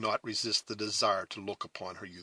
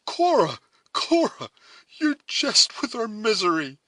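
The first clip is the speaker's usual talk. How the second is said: high, loud, impassioned protest; indignant, astonished; 13 semitones higher, 9 dB louder than usual. A narrator's reading. Duncan protesting Cora's horrid proposal, indignant outcry. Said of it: "the indignant and astonished youth".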